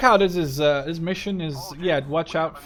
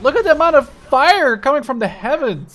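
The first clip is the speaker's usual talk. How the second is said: much higher and louder, thrilled exclamation; amazed excited shout; 9 semitones higher, 12 dB louder than usual. Real, not acted. Gamer to viewers watching the barrage, excited loud shout. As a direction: wow at the firepower raining down. Marvelling at air strike fire.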